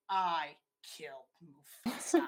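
forceful hoarse stage whisper, word by word; hammed-up whispery declaration with dramatic pauses; Scar's epic confession. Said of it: "in a great big epic way".

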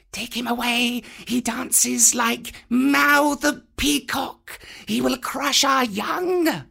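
very high, slow creepy serpent voices; cobras. A narrator's reading. Cobras in the dark telling Kaa to remove the boy. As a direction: hiss them out together. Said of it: "said the cobras inside".

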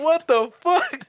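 high, loud mock-panic outcry; retold panicked disbelief. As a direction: cry out like an alarmed passenger. Retells panicked passenger.

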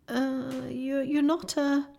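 high, faltering, fearful question; shaky voice. A narrator's reading. Susan afraid to say ghost, shaky voice. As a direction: stammer it nervously and leave it hanging. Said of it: "asked Susan in a shaky voice".

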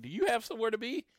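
high, fast mock indignant retort; exasperated retort. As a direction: throw it back at him, irritated and quick. Retold confronting rushing cashier.

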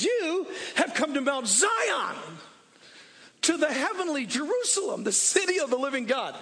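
rally the church like a coach at a game; full-force preaching. Acted. Proclaiming Mount Zion.

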